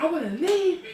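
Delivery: high, tearful plea; upset pleading. Retold or imitated. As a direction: say it close to tears. Crying daughter to father.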